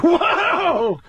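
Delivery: high, drawn-out mock-amazed whoa; sportscaster whoa. Comic re-enacting his fake sports highlights, amazed sportscaster. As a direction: play an astonished highlight announcer.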